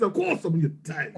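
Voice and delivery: quietly imitated Italian accent; mock Italian accent. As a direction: echo him with an Italian lilt. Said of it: "That was less New Yorkie, more Italian".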